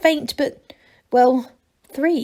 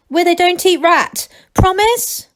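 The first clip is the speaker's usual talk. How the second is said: higher, louder anxious plea; pleading call; 9 semitones higher, 6 dB louder than usual. A narrator's reading. Angelo on the rope pleading with Masklin, louder. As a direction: beg for the promise.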